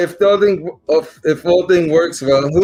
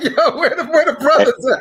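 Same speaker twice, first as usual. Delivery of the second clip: high, laughing mock search; laughing question; 10 semitones higher than usual. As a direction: giggle through the question. Joking question at the barbershop.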